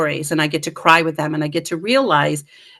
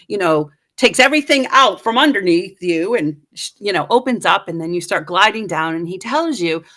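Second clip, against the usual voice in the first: ordinary talk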